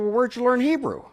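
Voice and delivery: very high, eager and amazed; excited question. Retold or imitated. Asking the stranger how he knew, high and excited. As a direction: press him, bursting with curiosity.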